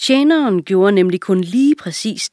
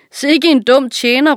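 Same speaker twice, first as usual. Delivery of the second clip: high, loud jeering call; mocking shout; 7 semitones higher, 6 dB louder than usual. A narrator's reading. Bystander shouts mockery at the servant, loud. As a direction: jeer it across the market. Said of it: "råbte en".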